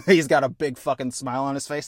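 normal talking voice

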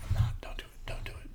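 hushed, unvoiced muttering to oneself; whispered self-warning. Reminding himself not to slip into an accent, whispered.

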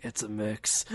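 low, soft gruff mumble; mock action star, gruff and low; Steven Seagal. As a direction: answer in a quiet low rumble. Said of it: "in a really low, gruff voice".

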